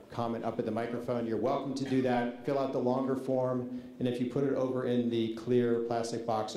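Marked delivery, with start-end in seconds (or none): none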